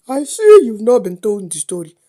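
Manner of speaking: loud, gloating laugh into the words. A narrator's reading. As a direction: laugh knowingly, then taunt them loudly. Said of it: "The uncle laughs".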